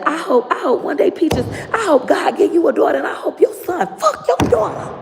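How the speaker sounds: high shouted grandma voice; imitating grandma's curse. Granddaughter mimics grandma cursing her, high shout. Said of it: "She said".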